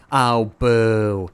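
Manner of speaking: playful loud jeer; mock booing. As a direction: heckle the game like an unhappy crowd. Booing the game in protest.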